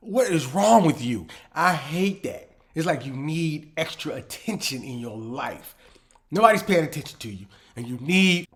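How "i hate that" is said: normal talking voice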